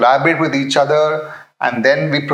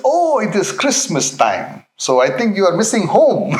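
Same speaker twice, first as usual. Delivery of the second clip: put-on Polish accent; 7 semitones higher than usual. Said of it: "in his Polish accent".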